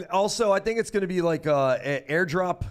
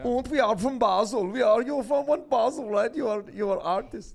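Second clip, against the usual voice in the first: bad put-on Swiss German accent, pitched up; hammed-up Swiss German accent on broken English; mock Swiss German accent; 6 semitones higher than usual. Mocking an artist from Basel in a bad Swiss German accent. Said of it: "definitely not the right accent".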